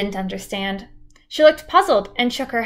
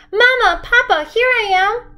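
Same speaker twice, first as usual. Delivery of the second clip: high, loud joyful shout; a lost girl shouting to her found parents; 12 semitones higher, 4 dB louder than usual. A narrator's reading. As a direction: call out to her parents across the park. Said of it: "Kristen shouted".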